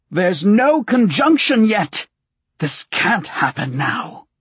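high, slow, raging witch shriek; a mad sorceress screaming in a shrill, foreign voice; playing both parts. A narrator's reading. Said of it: "she raged".

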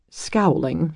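ordinary delivery, nothing marked

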